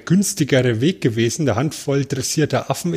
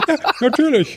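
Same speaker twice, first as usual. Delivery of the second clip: high-pitched, laughing agreement; amused exclamation; 12 semitones higher than usual. Real, not acted. Podcast host reacts to monkeys in costumes, laughing. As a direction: burst out with it through laughter.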